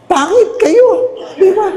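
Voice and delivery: high teasing punchline. Real, not acted. Scene: priest teases congregation about being unprepared, high and slow. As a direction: tease the crowd playfully.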